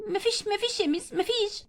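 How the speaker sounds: soft, small voice; timid denial. Acted. Crying girl quietly denies anything happened to the teacher. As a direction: hush it away shyly.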